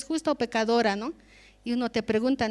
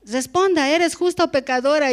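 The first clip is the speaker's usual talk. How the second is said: higher and louder, pressing for an answer; demanding question; 4 semitones higher, 5 dB louder than usual. Retold or imitated. Sister demanding she answer the question, louder and higher. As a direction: ask it like a church sister demanding a reply.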